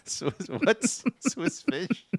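cracking up while talking, high and slow; real laughter. Real, not acted. Host cracks up at co-host's candy mix-up, laughing. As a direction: squeeze the words out through laughter.

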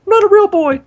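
Pinocchio voice. Retold or imitated. Pinocchio quote joke.

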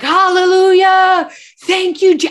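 high, loud cry of praise; joyful shout. Retold or imitated. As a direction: shout hallelujah at the top of your lungs. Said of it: "at the top of my lungs".

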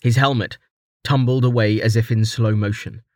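usual speaking voice

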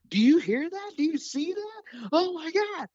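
high-pitched, gushing geek-out; nerdy excitement. Retold or imitated. Voice coach geeking out at a student, excited. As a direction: re-enact yourself nerding out, voice shooting way up. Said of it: "I get real passionate and nerdy and excited".

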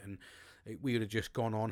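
normal talking voice

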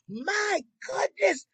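high, slow, marvelling cry; awed exclamation. Real, not acted. Friend marvelling over the huge engagement ring, high and slow. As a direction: gasp out your awe at the diamond.

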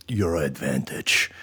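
"advantage" said low and slow; deliberate odd pronunciation. Real, not acted. Host reading his misspelt point title, deliberately odd pronunciation. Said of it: "I'm saying advantage like that".

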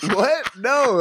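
high-pitched baffled denial; acted disbelief. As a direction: re-enact being taken aback. Reacts to brother's drunken suspicion.

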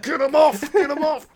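high, loud hammed-up get-em-off cry; mock frantic cue. Guest mock-signals producers to cut, high hammed-up cry. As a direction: playfully urge the producers to cut.

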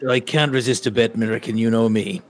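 Irish accent, hammed up. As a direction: play the Irish gambler who can't resist a bet. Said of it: "where did that accent come from?".

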